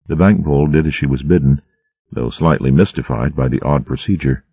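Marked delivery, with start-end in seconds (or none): none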